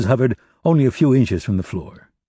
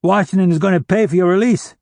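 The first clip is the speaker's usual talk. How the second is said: much higher, louder call across the room; yelling; 9 semitones higher, 4 dB louder than usual. Acted. Son yells across the cell to his father, loud and high. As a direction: shout to reassure his father.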